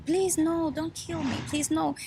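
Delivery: high-pitched mock yell, pleading; retold yelling. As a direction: imitate her begging, voice lifted without shouting. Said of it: "She was yelling".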